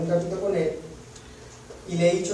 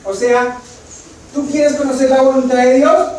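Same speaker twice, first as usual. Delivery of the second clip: louder, higher, ringing voice; preaching at full force; 6 semitones higher, 10 dB louder than usual. Real, not acted. Preacher crying out Paul's question, loud and high. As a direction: proclaim it to the whole hall.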